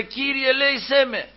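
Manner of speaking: high, repeated plea for mercy; acted high-pitched pleading cry; imitated crying out. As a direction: call out high like a desperate supplicant, without full volume. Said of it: "φώναζε".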